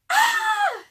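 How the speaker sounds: piercing high scream. Acted. Annie screams at the window, frightened. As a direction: shriek in sudden fright. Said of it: "screamed Annie".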